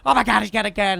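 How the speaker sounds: loud high panicked shout; panicked cry. Retold or imitated. Attackers seeing the gun, loud panicked shout. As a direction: yell it in alarm.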